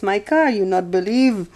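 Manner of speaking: a light Middle Eastern accent, lightly put on; mimicked foreign accent. Retelling the car seller's words in a light Middle Eastern accent. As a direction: mimic the man's broken English in a light accent. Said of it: "it was an accent from somewhere".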